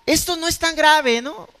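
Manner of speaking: a light put-on voice of someone brushing off a lie; mock excuse. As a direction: play down the lie, half-joking. Pastor mimics excuse-making liar. (voz burlona de quien minimiza su mentira)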